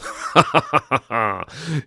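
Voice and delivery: a smug put-on cackle at his own trolling; mock gleeful laugh. Host laughing to bait conservative viewers, smug cackle. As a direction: laugh like he's poking the bear. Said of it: "See what I'm doing there?".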